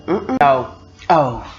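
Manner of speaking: ordinary talk, nothing marked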